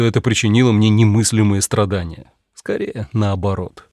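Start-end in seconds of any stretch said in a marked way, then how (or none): none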